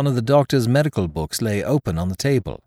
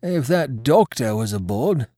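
higher-pitched sailor's bark; 5 semitones higher than usual. A narrator's reading. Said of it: "I might say he barked".

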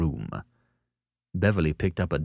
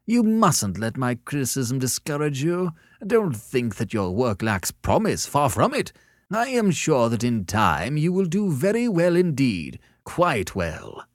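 high-pitched, patronizing encouragement; gracious condescension; 8 semitones higher, 4 dB louder than usual. A narrator's reading. Sellers tells a beginner his work has promise, graciously condescending. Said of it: "said Sellers graciously".

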